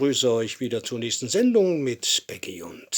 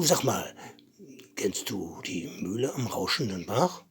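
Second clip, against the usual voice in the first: usual speaking voice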